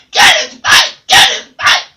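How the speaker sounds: hissed, loud raspy bird whisper; loud unvoiced parrot rasp. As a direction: rasp it out like a parrot egging on a dog. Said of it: "Barry jumped up in the window and said".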